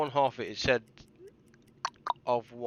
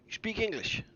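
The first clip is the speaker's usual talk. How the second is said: mock tourist question; 10 semitones higher, 3 dB quieter than usual. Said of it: "and go".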